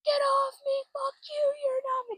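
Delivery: very high, thin falsetto scream; mock scream. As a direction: squeal it as a held-back retold scream. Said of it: "Like, she's screaming".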